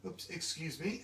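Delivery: plain reading voice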